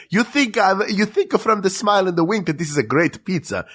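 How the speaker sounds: high, stretched-out cartoon Italian accent. Pizza box mascot confesses his pizza is okay, mock Italian accent. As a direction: play the trickster mascot with hammy Italian lilt. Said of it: "He's like".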